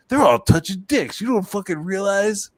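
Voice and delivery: high, mocking director voice. Director revealing the subtext, high mocking. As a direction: lampoon the commentary. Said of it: "it's like".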